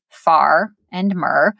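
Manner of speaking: "far" and "mer" said slowly as two separate syllables; syllables split. Shown as a demonstration. Teacher splitting farmer into syllables, slow and deliberate. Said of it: "farmer has two syllables".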